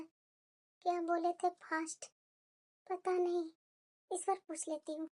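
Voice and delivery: very soft, low self-talk; hushed, uncertain aside; puzzled muttering. Acted. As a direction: mutter slowly as you try to remember. Wife keeps puzzling to herself.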